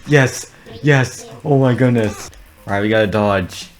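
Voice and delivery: ordinary talk, nothing marked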